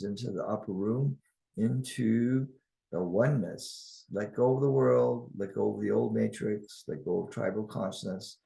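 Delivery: calm, measured guiding voice with long pauses; guided meditation. Acted. Guide leading listeners into oneness and harmony, calm guided meditation. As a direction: lead them unhurriedly through the imagery.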